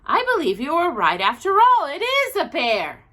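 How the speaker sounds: wife admits he was right; exclaimed. Acted. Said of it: "she exclaimed".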